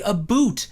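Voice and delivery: "a boot" for "about"; mock Canadian "about". Shown as a demonstration. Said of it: "We don't say".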